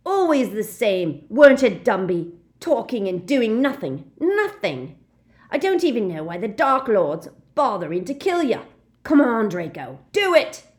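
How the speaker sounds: loud high raging taunt; enraged thug shouting; furious shout. Acted. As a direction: scream for the kill. Death Eater raging at Dumbledore.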